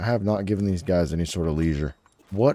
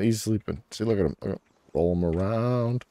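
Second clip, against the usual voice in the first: ordinary delivery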